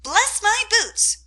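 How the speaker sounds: high, slow, loud squawk of surprise; astonished parrot croak. A narrator's reading. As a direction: croak it out like a startled bird. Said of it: "an astonished croak and call out".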